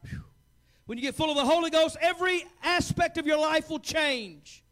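rising shout of a fiery preacher; preaching at full force. Acted. Pastor to his church, preaching at full force. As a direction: preach it hard and build.

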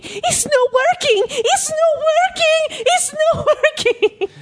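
high desperate scream, slow. Retold or imitated. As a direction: wail it out in a panic. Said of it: "just screaming".

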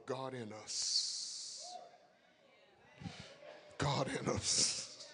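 "God in us" drawn out very slowly; slow emphasis. Shown as a demonstration. Preacher stressing final point, very slow "God in us". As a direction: let each word of "God in us" land. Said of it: "preacher leading phrase".

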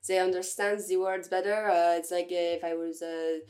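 put-on French accent in English. Shown as a demonstration. Student showing off her French accent to friends, playful French-accented English. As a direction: speak English like a hesitant Parisian, French accent. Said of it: "I'm really good at doing the French accent".

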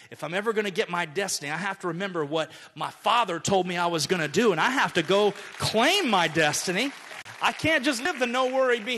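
rising to a loud, fast preach; building preaching. Shown as a demonstration. Pastor to congregation, building to a loud, fast preach.

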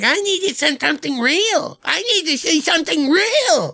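very high mimicking whine; hammed-up mocking imitation; mocking her demand. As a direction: parrot the girlfriend in an exaggerated high voice.

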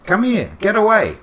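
calling a dog across the park, loud. Retold or imitated. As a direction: yell it out to the dog. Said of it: "he yells out".

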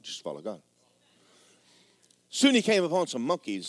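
ordinary talk, nothing marked

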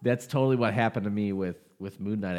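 ordinary talk

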